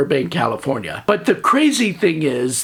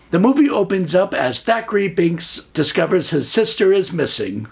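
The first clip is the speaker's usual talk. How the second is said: usual speaking voice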